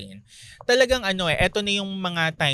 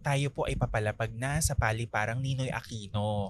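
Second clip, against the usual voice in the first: Bernadette Sembrano impression; 5 semitones lower than usual. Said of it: "Bernadette Sembrano".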